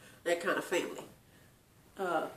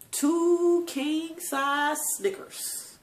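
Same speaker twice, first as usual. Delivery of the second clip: slow, higher-pitched savouring of each word; drawn-out emphasis; 6 semitones higher, much slower than usual. Real, not acted. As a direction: stretch every word out high. Dwells on the gift he brought.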